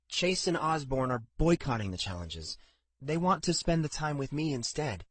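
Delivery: a hint of a hesitant daughter; young woman voice. A narrator's reading.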